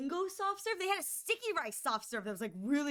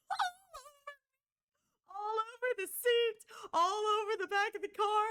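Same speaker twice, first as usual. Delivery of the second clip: dismayed wail; 10 semitones higher than usual. Retold or imitated. Tea spilling everywhere.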